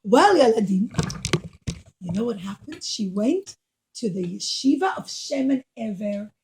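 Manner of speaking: ordinary delivery, nothing marked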